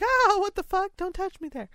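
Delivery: high-pitched squeal of objection; mock startled protest. Imagining being tickled by someone's touch, mock startled protest. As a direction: recoil from a ticklish touch, playfully exaggerated.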